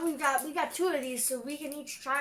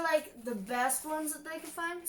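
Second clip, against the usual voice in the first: ordinary talk, nothing marked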